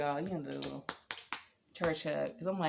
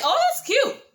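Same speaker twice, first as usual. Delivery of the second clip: gushing; 9 dB louder than usual. Shown as a demonstration. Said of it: "I think it's cute".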